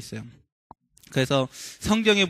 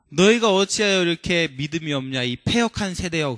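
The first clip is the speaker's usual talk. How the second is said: ordinary talk, nothing marked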